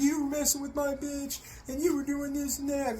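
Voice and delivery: sneering imitation of the informant's complaint; mocking whiny voice. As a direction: parody his excuse in a squeaky tone.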